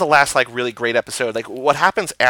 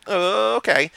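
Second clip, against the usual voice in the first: unimpressed; 7 semitones higher, slower than usual. Retold or imitated. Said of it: "It's like".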